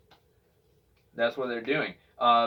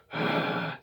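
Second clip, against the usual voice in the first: long weary sigh; long tired sigh. Shown as a demonstration. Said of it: "this just long sigh".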